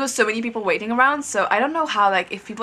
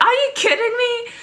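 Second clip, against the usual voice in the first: very high indignant squeal with a laugh; retold snapping; 4 dB louder than usual. Fan retelling how she snapped at the idol, mock outrage. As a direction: snap it high, half laughing. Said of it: "I just snapped".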